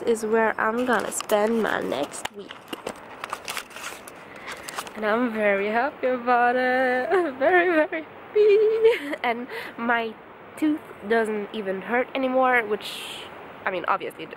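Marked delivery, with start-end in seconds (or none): 4.9-9.2 laughing. Real, not acted. Laughing overjoyed about the camp.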